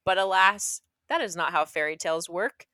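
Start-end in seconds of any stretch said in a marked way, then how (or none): none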